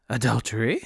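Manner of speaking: mildly shocked, rising question; gasped. A narrator's reading. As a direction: echo it in understated disbelief. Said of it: "Luque gasped".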